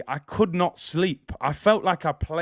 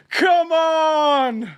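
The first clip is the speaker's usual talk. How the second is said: high, drawn-out rallying shout; celebratory yell; 11 semitones higher, 4 dB louder than usual. Real, not acted. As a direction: let out a long, high victory cry. Hyped celebration after the chant.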